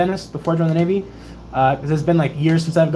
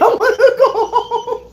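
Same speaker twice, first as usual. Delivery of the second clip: high mock bawling, half laughing; retold ugly crying. Patient to lieutenant commander, comic ugly crying. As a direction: wail it like a blubbering child. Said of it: "I was ugly crying".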